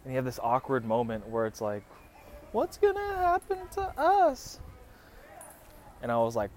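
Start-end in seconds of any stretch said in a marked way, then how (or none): 2.5-4.6 very high, slow falsetto imitation; hammed-up girlfriend squeal, drawn out; mock girlfriend voice. Girlfriend asks about the move in an awkward moment, mock girlfriend voice.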